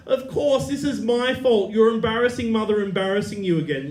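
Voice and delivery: Mum's high, loud self-pitying accusation; guilt-trip your son in a high, loud voice; projecting blame. Retold or imitated. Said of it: "she projects on me".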